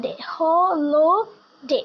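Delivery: drawn-out syllable and whole word; slow down and stretch the word; slow syllable then word. Shown as a demonstration. Teacher slowly sounding out holiday for the class, drawn out. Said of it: "প্রনাউন্সিয়েশনটা break করে".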